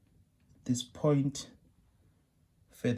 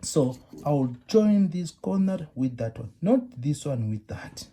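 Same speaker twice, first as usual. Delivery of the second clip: louder on this corner, not this one; teacher contrasting right and wrong join, louder; emphatic contrast; 3 semitones higher, 6 dB louder than usual. Shown as a demonstration. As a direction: stress the correct join over the wrong one. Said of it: "That's how you do it".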